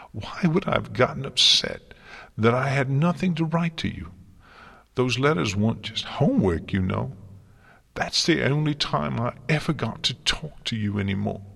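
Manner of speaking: high, loud, wounded sarcasm; upset sarcasm. A narrator's reading. Twilight telling her mentor the letters weren't just homework, sarcastic. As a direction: push pitch and volume up, bitterly hurt. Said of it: "sarcasm running thick through her agony of sobs".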